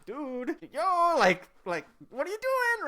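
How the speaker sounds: high, urgent warning from a cousin; imitated alarm. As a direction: warn your cousin to stop, pitch climbing. Cousins warn him mid-rant.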